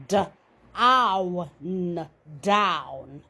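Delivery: D-ow-n in separate sounds, then down said low; sound out then blend. Shown as a demonstration. Teacher models blending for young readers, step by step. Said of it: "we change the sound to ow".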